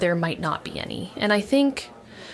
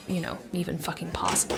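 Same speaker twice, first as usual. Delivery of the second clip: whispered aside; 3 semitones lower, 8 dB quieter than usual. Acted. Wary aside about drawing attention.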